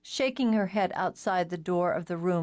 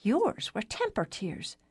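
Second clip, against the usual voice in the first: high, prim and slow; a prim little sister's reproach; 4 semitones higher, slower than usual. Acted. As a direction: primly correct her sister. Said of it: "Alice May said primly".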